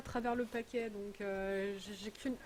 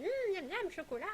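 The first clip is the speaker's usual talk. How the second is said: imitating her daughter; 8 semitones higher than usual. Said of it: "Elle m'a fait".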